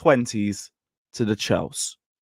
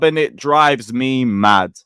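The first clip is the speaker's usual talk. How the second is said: usual speaking voice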